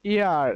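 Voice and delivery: "ইয়ার" with no H at the start; silent H. Shown as a demonstration. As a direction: begin "ইয়ার" directly on the vowel sound. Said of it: "এর উচ্চারণ হচ্ছে ইয়ার". ("ইয়ার" এইচ উচ্চারণ না করে)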